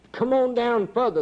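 high ringing call of the Lord. Retold or imitated. Preacher voicing the Lord calling him down, high call.

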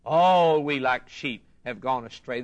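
normal talking voice

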